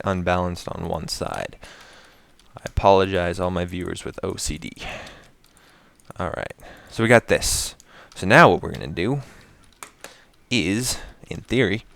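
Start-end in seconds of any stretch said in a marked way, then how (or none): none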